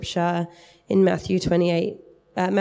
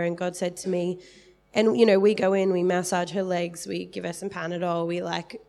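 normal talking voice

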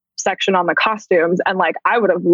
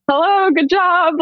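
awkward greeting; 10 semitones higher, slower than usual. Retold or imitated. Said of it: "but I was so, like".

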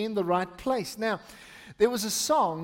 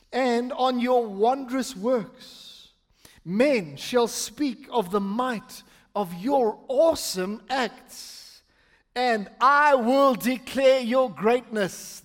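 slow, deliberate psalm declaration; emphatic scripture reading; 3 semitones higher, slower than usual. Acted. Pastor reading the psalm to the church, slow and emphatic. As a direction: lean on the key words and stretch them. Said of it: "read it with emphasis on different things".

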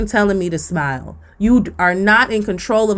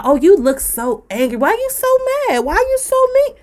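rising to a much higher mock whine; mocking catcaller; 10 semitones higher than usual. Imitating people telling her to smile, in a high whine. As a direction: mimic the nagging smile-demander. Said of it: "Stop telling me to smile".

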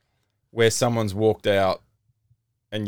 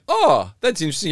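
mock polite interest; 6 semitones higher than usual. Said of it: "I just went".

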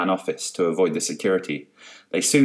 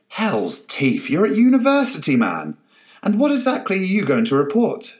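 high loud exasperation; exasperated; 11 semitones higher, 4 dB louder than usual. Acted. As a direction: throw up your hands in disbelief. Carol exasperated.

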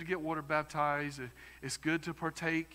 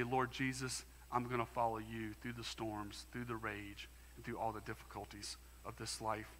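much softer and lower, fading prayer; quiet prayer; 5 semitones lower, 9 dB quieter than usual. Pretend. Believer vowing to follow Jesus through storms, hushed prayer. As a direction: pray it softly as a vow. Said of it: "and say".

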